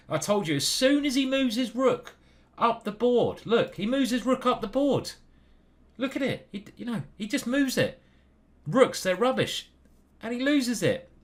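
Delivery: high-pitched, animated I-told-you-so; excited gloating. Real, not acted. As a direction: pitch it way up, crowing. Gleefully explaining the rook blunder.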